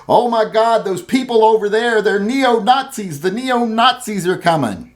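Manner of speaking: a hammed-up scare voice crying wolf; mock alarmed media. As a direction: imitate a breathless news alarmist. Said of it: "you see all the time these people going".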